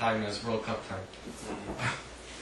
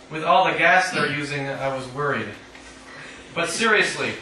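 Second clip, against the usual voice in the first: measured, deliberate reading voice; poetry reading; 6 semitones higher, 6 dB louder, slower than usual. Acted. Poet reading her own poem to an audience, measured reading voice. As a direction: read each line out to the room.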